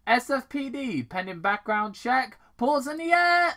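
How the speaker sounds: high, loud police command; mock cop shout. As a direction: play the cop barging in on raccoons. Tom storms garage with gun.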